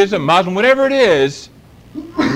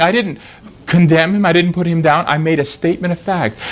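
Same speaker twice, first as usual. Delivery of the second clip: ordinary delivery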